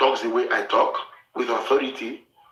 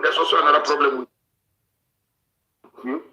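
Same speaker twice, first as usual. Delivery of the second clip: slow and deliberate; slower than usual. Acted. Slow emphatic announcement.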